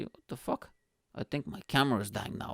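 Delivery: ordinary talk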